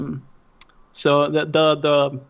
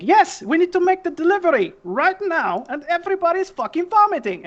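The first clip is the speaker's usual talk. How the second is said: exasperated high-pitched yell; 13 semitones higher, 3 dB louder than usual. Acted. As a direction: yell back impatiently, pitched way up. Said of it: "she starts yelling at Smoke".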